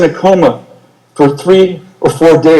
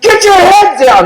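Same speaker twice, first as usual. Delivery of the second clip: screaming order. Retold or imitated. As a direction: scream it across the field. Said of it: "the sergeant's screaming".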